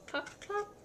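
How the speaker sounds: high, bouncy clip-clop; clip-clop hooves. Acted. Parent reading to child, high hoof sounds.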